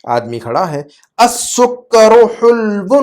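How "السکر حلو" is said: very slow, raised-pitch Arabic recitation; model reading. Shown as a demonstration. Teacher reading a sentence for translation, very slow model reading. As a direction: draw out each syllable, pitched up. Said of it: "جملہ پڑھتا ہوں".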